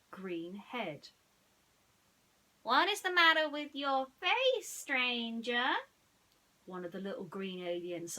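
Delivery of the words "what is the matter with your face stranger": higher, louder, prying; curious alien. A narrator's reading. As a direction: question the stranger bluntly. Alien asks newcomer.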